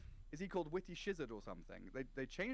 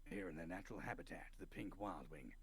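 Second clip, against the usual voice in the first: lower, hushed wildlife narrator; nature documentary; 6 semitones lower, 6 dB quieter than usual. Pretend. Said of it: "That's why you're such a good dungeon master".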